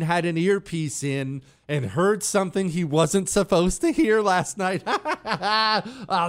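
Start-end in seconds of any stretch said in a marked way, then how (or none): 4.9-6.3 real laugh into the word; chuckling. Real, not acted. Laughs at caller's joke.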